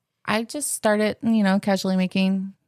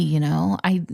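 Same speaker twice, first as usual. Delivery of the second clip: normal talking voice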